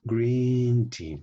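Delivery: "green tea" with GREEN held long and high; drawn-out practice demo. Shown as a demonstration. Teacher shows how to practise, "green tea" drawn out. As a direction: stretch "green" high, then drop on "tea". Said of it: "you have to practice like this".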